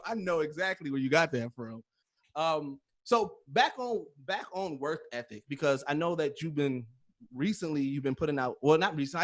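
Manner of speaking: usual speaking voice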